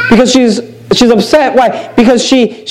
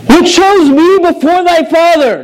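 higher, slower, cutting retort; David retorting; 8 semitones higher, slower than usual. Retold or imitated. David answering Michal's scorn, higher and slow. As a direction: throw it back at her pointedly. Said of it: "And David said unto Michal".

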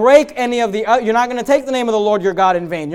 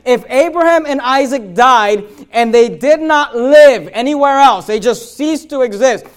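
higher and louder, slow and building; full-force preaching; 4 semitones higher, 7 dB louder than usual. Acted. Pastor driving home the resurrection to his church, building preaching. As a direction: preach the climax with rising force.

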